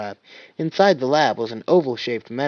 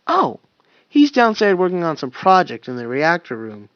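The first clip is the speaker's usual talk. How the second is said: bright, higher, cheerful report; pleasant; 5 semitones higher, 5 dB louder than usual. Acted. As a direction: sound blissfully unbothered. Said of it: "Doc said pleasantly".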